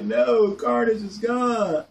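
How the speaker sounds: half-joking grief over a dead favourite; mock anguished no. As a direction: wail it like a heartbroken fan. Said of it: "I was like".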